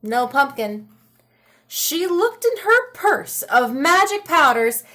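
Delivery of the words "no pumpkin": usual speaking voice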